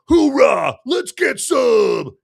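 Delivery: loud, high war cry, then a slow drawn-out shout; gung-ho soldier. Retold or imitated. Mocking the bloodthirsty soldiers in Avatar, loud gung-ho yell. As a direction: bellow it like a bloodthirsty marine. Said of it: "caricatures of, you know, bloodthirsty soldiers".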